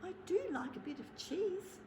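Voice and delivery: a cheeky little mouse hint. Acted. The mouse hints to Bear about cheese in a small voice. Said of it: "said the mouse".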